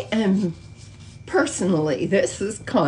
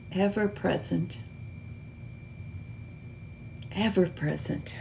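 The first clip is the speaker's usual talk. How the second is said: guided meditation; 7 dB quieter than usual. Acted. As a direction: gently lead them into stillness, softer and unhurried. Leads a slow meditation.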